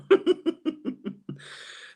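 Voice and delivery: airy unvoiced laugh; breathy laugh. Real, not acted. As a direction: laugh silently.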